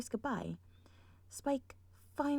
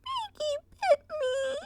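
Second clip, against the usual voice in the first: high, slow tearful complaint; crying filly; 6 dB louder, slower than usual. Acted. Rarity tattling on Pinkie, high slow wail. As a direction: wail it like a bitten toddler. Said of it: "says Rarity".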